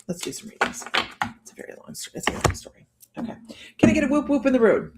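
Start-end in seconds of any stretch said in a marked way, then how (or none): 1.4-2.9 breathy whispered aside. Real, not acted. Whispering to the chat that it's a long story. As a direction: drop to a whisper, just for the chat. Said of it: "whispered aside".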